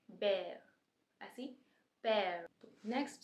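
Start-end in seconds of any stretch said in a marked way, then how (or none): 0.1-0.6 बेयर said with the air sound, not बियर; correct bear. Shown as a demonstration. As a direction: say बेयर as "bear", open e glide. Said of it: "लेकिन क्या होता है".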